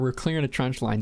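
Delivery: ordinary delivery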